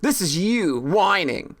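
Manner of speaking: normal talking voice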